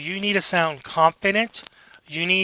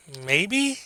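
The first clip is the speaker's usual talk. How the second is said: "Maybe" swooping upward like a question; rising inflection; 2 semitones higher than usual. Shown as a demonstration. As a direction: answer "Maybe" unsurely, pitch climbing at the end. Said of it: "you're putting a rising inflection on it".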